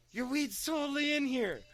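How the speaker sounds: high, loud retold squeal; amazed discovery. Friend finding the weed back in the bag, amazed.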